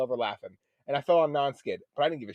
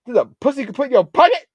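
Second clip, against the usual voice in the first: much higher, fast incredulous question; mock disbelief; 13 semitones higher, 6 dB louder, faster than usual. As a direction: rush it out in disbelief. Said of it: "we're like".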